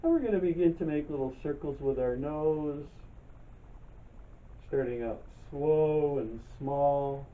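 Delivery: calm, unhurried meditation guide, long pauses between phrases; guided meditation. Real, not acted. Leads breathing and chair yoga.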